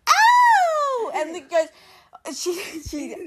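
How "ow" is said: very high, loud yelp; high drawn-out cry of pain, imitated; little kid's ow. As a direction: yelp like a hurt little kid. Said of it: "little me goes".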